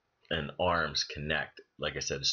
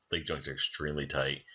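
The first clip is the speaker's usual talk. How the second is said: ordinary talk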